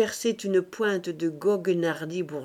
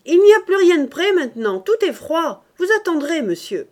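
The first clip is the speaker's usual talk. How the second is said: fast, much higher and louder, raging; furious cook; 9 semitones higher, 11 dB louder than usual. A narrator's reading. Cook scolding the master about the cold dinner, furious. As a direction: fire it off in a temper. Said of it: "la voix furieuse de la cuisinière".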